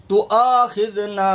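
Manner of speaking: تؤاخذنا chanted with a long-held final naa; elongated madd. Shown as a demonstration. Teacher shows the madd, long drawn ending on تؤاخذنا. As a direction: stretch the last vowel of تؤاخذنا. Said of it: "تین انگلیوں کی مقدار کھینچیں گے".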